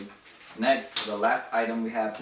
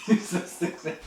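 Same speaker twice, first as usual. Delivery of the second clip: laughing; 9 semitones higher, 4 dB louder than usual. Real, not acted. Laughing at friend during outro.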